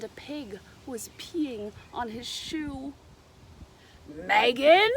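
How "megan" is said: loud call of her name; yelling. A narrator's reading. Father scolds Megan for the open gate, loud call. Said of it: "yelled her father".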